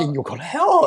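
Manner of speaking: high, loud echo of a hellfire threat; mock warning. Storyteller echoing guest's religious warning, mocking.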